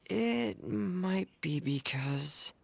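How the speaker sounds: halting, stretched-out stammer; flustered hesitation. Acted. Shy crown maker explains his dedication to the fairy, flustered. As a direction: stall while searching for an excuse. Embarrassed, struggles to explain himself.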